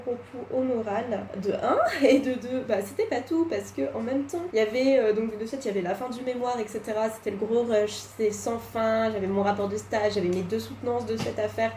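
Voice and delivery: usual speaking voice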